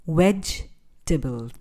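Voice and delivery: ordinary delivery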